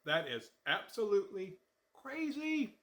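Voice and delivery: drawn-out mock disbelief; incredulous thought. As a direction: act out your own shocked first reaction. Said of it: "I thought".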